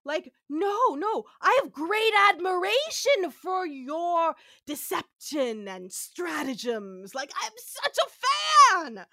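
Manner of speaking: gushing fan, high, loud and drawn out; lavish exaggerated admiration on them; Trelane. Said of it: "And Trelane is like".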